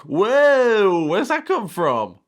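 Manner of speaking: loud, high, drawn-out whoa; shocked outburst. Real, not acted. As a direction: burst out loudly in high-pitched disbelief.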